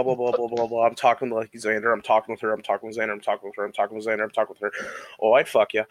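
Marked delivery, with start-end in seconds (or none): 5.2-5.8 put-on Yooper accent. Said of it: "what kind of accent were you using".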